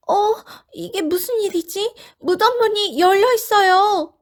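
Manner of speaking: high, loud startled voice; surprised. Acted. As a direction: react to the open tomb. Women surprised at the open tomb.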